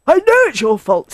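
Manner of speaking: loud, high, angry retort; furious. A narrator's reading. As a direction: snap back in blame. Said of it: "said Badger furiously".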